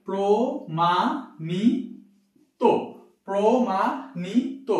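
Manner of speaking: প্রমাণিত drawn out very slowly, ending on o; প্রমাণিত said as promanito at a crawl; slow demo word. Shown as a demonstration. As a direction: stretch every syllable of প্রমাণিত for the class. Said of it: "তাহলে কি হবে?".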